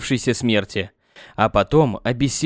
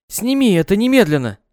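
визг; 7 semitones higher, 4 dB louder than usual. Acted. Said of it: "а визжала".

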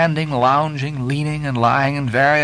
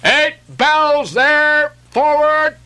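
much higher, louder, slow ship's call across the deck; mate's call; 4 dB louder, much slower than usual. Acted. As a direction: bellow the order forward from the quarterdeck. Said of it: "Mate's voice from the quarterdeck".

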